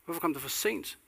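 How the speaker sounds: shouting after Jesus where he was and why he came too late; calling out to Jesus. Pretend. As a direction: cry out after him, demanding an answer. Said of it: "råbe efter ham".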